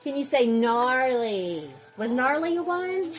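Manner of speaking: high, sing-song cheer. Real, not acted. Mother cheers her baby's word high and sing-song.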